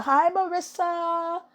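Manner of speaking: high, slow, drawn-out hello; shout-out greeting. Real, not acted. Greeting the bakery owner Marissa on camera, high and drawn out.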